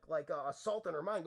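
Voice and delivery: normal talking voice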